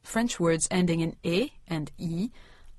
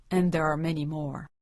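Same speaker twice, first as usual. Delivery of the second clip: normal talking voice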